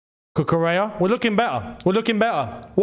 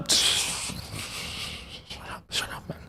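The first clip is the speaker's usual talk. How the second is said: unvoiced whisper; 10 dB quieter than usual. Real, not acted. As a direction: breathe it out softly. Playful dismissal.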